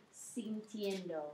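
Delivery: "sintiendo" drawn out slowly, syllable by syllable; slow "sintiendo" down so students catch it; teacher spells out "sintiendo" slowly; slow model word. Shown as a demonstration. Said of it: "And in the progressive".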